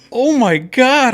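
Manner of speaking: high, loud, drawn-out mock horror; shocked outcry. Woman caught in a fart at the bar, mock-horrified cry.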